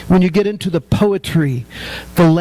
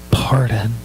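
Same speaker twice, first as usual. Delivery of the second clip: whisper; 6 semitones lower than usual. Acted. Quoting God's promise of pardon.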